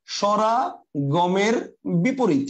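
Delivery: "স্বরাগমের বিপরীত" word by word, with gaps; very slow dictation. Shown as a demonstration. Teacher repeating the definition for students writing it, very slow dictation. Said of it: "লিখেন".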